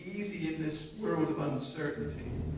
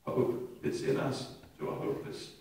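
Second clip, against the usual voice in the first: ordinary talk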